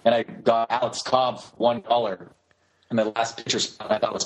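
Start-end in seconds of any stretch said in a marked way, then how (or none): none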